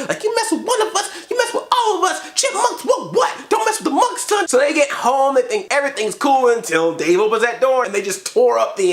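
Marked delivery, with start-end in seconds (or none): 0.2-4.5 squeaky high tough-guy threat; chipmunk gangster. Retold or imitated. Reviewer voicing Alvin threatening like a gang, high and squeaky. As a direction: act hard but squeak it out. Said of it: "talking to him like they're a freaking gang".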